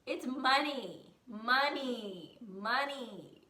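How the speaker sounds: "money" stretched out loud each time; loud, slow "money", falling away each time; emphatic repetition. Real, not acted. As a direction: draw out and punch every "money". Emphatic punchline after a joke.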